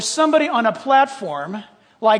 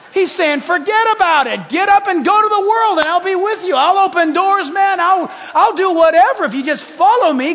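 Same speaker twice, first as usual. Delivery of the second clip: loud, high, shouting sermon voice; full-force preaching; 7 semitones higher, 5 dB louder than usual. Acted. Pastor urging the congregation to go, in a loud, high preaching shout. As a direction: preach it out with fire.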